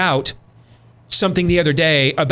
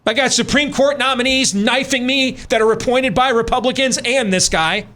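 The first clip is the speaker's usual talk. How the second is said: ordinary talk, nothing marked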